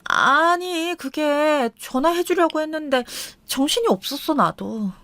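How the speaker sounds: high, defensive excuses; flustered excuse-making. Acted. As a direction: squirm and make excuses. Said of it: "움찔하더니 다시 둘러대는 거예요".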